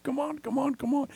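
a surfer urging the wave to come; eager surfer. Retold or imitated. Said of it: "Like you're a surfer waiting for the wave".

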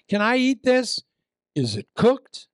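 high, loud worried question; anxious tourist. Retold or imitated. Preacher mimicking a tourist checking street bread, high and fretful. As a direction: fret over the bread, voice up high. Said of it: "You're out in the street".

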